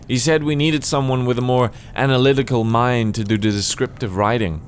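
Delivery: usual speaking voice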